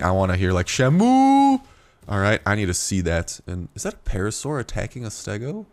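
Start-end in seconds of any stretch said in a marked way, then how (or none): 0.7-1.6 Shamu soaring into a loud, long "ooh"; exaggerated pronunciation. Shown as a demonstration. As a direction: swoop up high on the end of Shamu and hold. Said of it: "pronouncing Shamu correctly".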